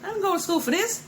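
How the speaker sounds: high, squeaky voice of her younger self protesting; mock whiny protest. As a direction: pitch it way up, like a complaining kid. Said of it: "And I'm like".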